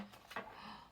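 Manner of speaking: soft whispered gasp of discovery; hushed storytime gasp; mock gasp. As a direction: gasp quietly, pretend surprise. Spotting someone in the tree.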